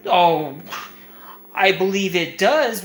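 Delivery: ordinary talk, nothing marked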